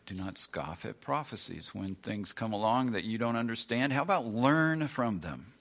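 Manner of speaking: ordinary talk, nothing marked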